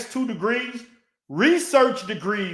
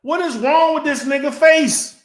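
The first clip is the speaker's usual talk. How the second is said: louder, demanding what is wrong; loud incredulous rant; 6 dB louder, slower than usual. Real, not acted. Ranting at his rival's looks, loud and incredulous. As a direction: shout the question in disbelief.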